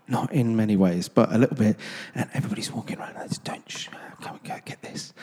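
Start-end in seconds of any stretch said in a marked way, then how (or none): 2.1-5.1 whispering shoppers keeping their voices down; furtive, unvoiced whisper; hushed whisper. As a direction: whisper it like you're shushing someone in a cathedral. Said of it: "everybody just talks like this".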